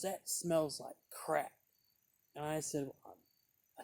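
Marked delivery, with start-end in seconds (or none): 0.0-1.5 repeated dismissal. Retold or imitated. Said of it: "she just goes".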